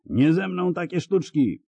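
high defiant roar. A narrator's reading. The hunter roars while fighting his horse. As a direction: shout down the magic trick. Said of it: "ryknął łowca".

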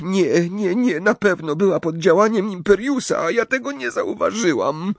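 tearful. A narrator's reading. Leni explains the quarrel with Katy, tearful. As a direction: build towards sobbing, voice climbing. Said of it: "I zaniosła się szlochem".

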